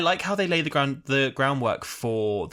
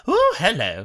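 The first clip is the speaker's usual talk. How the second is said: up-and-down cadence; 5 semitones higher, 5 dB louder than usual. Retold or imitated. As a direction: mimic her swooping lilt. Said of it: "She goes up and down".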